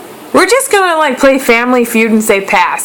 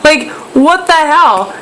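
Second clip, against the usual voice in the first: retold outburst; 7 semitones higher than usual. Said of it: "And I was like".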